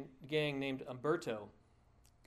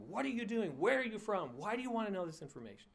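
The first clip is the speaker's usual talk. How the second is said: mock yell, pitched much higher, racing at the end; retold screaming; 7 semitones higher than usual. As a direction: shout the gang's threats as if replaying them. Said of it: "started screaming at them".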